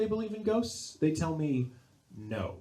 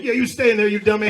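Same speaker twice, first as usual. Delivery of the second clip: loud, raised-pitch order at the closet; mock tough taunt; 6 semitones higher, 12 dB louder than usual. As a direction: yell a warning to the dummy inside. Guarding closet, taunting the dummy.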